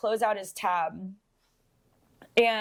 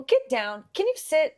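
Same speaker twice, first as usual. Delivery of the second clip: talking to the dog; 6 semitones higher than usual. Real, not acted.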